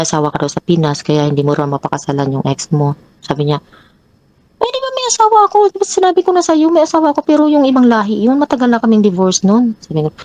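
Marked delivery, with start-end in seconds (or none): 4.6-9.8 high, fast, defensive imitation of the boyfriend; voicing the boyfriend. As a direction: mimic his quick excuses in a higher voice. Partner's defensive reply voiced. (ginagaya ang boyfriend, mataas at mabilis)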